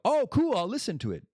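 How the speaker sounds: high, loud, hammed-up polite enthusiasm; mock enthusiastic listener. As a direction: play someone brushing him off with fake cheer. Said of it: "they look at me and go".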